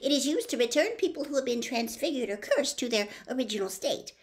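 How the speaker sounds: prim, eager know-it-all; reciting the textbook. Acted. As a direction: rattle it off like a memorized page. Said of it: "as though she had swallowed the textbook".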